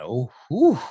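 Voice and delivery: ordinary talk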